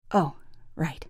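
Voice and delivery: barely voiced, shrinking from him; quiet mumble. Wife muttering back to her cold husband, quietly. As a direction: murmur it low, deflated.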